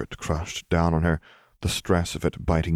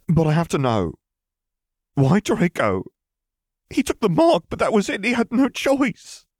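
high, loud, distraught plea; desperate defence of a loved one, pitched very high; anguished protest; 13 semitones higher, 4 dB louder than usual. A narrator's reading. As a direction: demand why, climbing higher and louder. Pansy protests Draco's death.

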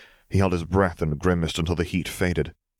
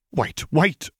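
high, urgent cry to stop; frightened, high-pitched appeal; panicked plea; 11 semitones higher than usual. A narrator's reading. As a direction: beg for a moment in alarm. Pansy begs as she is cornered.

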